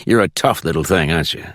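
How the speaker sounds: mildly combative judge character; lightly voiced judge. A narrator's reading. Judge answering his visitor about his court, lightly voiced.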